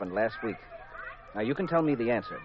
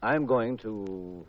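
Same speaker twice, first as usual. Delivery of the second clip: very slow, drawn out; dramatic stall; 5 semitones lower, much slower than usual. Acted. [name] teases Jean with the big reveal, very slow. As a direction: drag it out for suspense. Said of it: "announced very solemnly".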